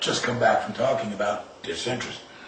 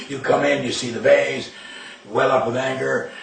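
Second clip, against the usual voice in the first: ordinary delivery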